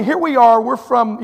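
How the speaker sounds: usual speaking voice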